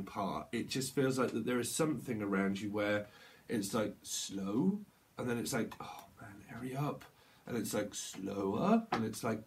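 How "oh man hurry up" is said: whispered impatience. Pretend. Impatient channeled inner voice.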